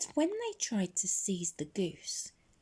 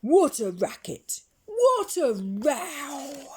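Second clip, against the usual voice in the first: loud, slow amazed exclamation; exclaiming; 9 semitones higher, 7 dB louder than usual. A narrator's reading. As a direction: marvel at the noise. Narrator exclaims at the din.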